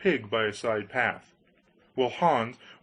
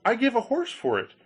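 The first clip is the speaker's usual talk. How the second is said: eager Hans voice; 11 semitones higher than usual. Acted. Hans answers.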